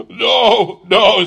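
high, loud cry of protest; distressed refusal. A narrator's reading. Scrooge protests the child's foretold death, distressed. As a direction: cry out against the prophecy. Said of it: "said Scrooge".

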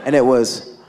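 ordinary talk, nothing marked

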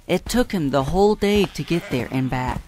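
ordinary delivery

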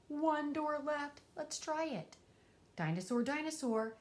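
plain reading voice

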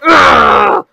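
a loud, high disgusted groan; fed-up groan shout. Acted. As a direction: explode in frustration. Fed-up outburst.

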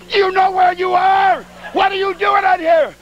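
high, loud, slow reproach; scolding wife. Retold or imitated. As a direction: drag out the scolding, shocked.

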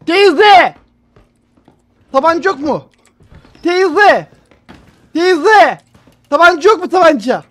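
loud, high calls across the room; calling out loud. Real, not acted. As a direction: call her loudly. Calling out to the aunt.

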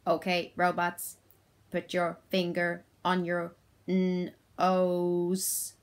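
slow monotone robot, word by word. Shown as a demonstration. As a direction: give robot orders, splitting NOSE into n and ose. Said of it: "saying in my robot voice".

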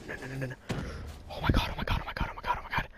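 rapid nervous whispered chant; breathless whispered repeat; tense whisper. Real, not acted. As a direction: whisper it as if holding your breath. Tense moment before streak.

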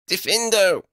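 high-pitched spell cry; high, forceful casting call; cried out. A narrator's reading. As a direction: cry out the incantation. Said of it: "she cried".

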